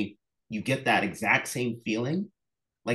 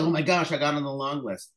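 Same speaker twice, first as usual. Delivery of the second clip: higher, giddy retold thrill; thrilled gushing; 6 semitones higher than usual. Author retelling the long-list news, giddy and higher. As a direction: gush like you just made the long list. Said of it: "you're just so thrilled".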